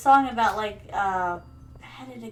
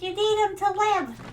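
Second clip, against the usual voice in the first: nagging mom; 8 semitones higher than usual. Retold or imitated. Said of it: "she's like".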